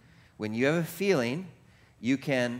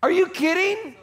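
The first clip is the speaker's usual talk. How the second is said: much higher, louder, disbelieving cry; incredulous; 7 dB louder than usual. Acted. Pastor marvelling to the church, incredulous high cry. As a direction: throw it up in amazed disbelief.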